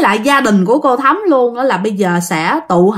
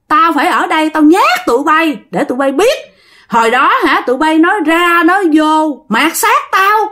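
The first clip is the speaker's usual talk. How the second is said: higher-pitched, stubborn ghost rant; defiant ghost; 5 semitones higher than usual. Acted. Wronged ghost refusing villagers' plea, defiant and resentful. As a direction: refuse to leave, reproaching in raised pitch. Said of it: "nói quá trời nói".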